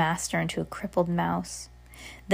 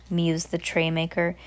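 ordinary delivery

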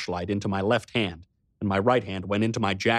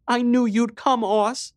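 put-on high young girl's voice, still teary; a girl just done sobbing, relieved; tearful little sister; 13 semitones higher, 4 dB louder, slower than usual. A narrator's reading. Riley speaks after sobbing.

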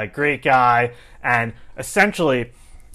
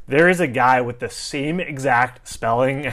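usual speaking voice

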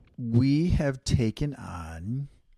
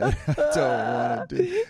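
mock crying; 6 semitones higher, 8 dB louder than usual. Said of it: "you were saying".

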